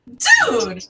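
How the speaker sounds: exasperated retort. Retold or imitated. Retorting to Filipino questioners.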